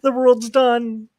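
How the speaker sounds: high, drawn-out mock wail; despairing goths. Goths realize Dracula dislikes The Cure, high slow mock despair. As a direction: wail that everything is ruined, slowly. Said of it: "they're like".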